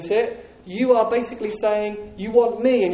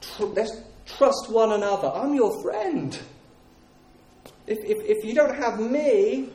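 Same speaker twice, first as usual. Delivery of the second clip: stronghold voice; slower than usual. Acted. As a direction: draw them in slowly, falsely friendly. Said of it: "He says".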